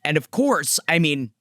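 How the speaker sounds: ordinary talk, nothing marked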